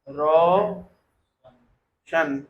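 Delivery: रोशन stretched very slowly, long first syllable, pause, then शन; रोशन pulled apart for spelling. Shown as a demonstration. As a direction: dictate रोशन in two drawn-out pieces. Teacher sounding out a name.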